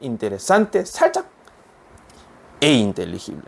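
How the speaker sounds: "interesante", a small gap, then "e inteligible"; slight pause. Shown as a demonstration. Teacher showing students the short break before "e". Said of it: "이렇게 불러주시면 돼요".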